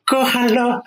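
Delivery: imitating a high-pitched cry for help; retold shout. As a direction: call out like a robbed friend, half laughing. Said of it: "empezó a gritar".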